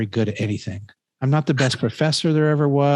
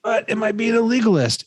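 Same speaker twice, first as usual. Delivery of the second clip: pleading question; 9 semitones higher than usual. Retold or imitated. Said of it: "And I said".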